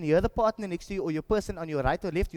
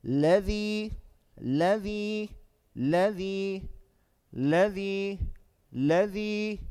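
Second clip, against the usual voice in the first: chanted word with a rising, held ending; measured tajweed drill for repetition; Quran recitation; 3 semitones higher, much slower than usual. Shown as a demonstration. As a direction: recite it evenly, stretching the last vowel. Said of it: "say the next word".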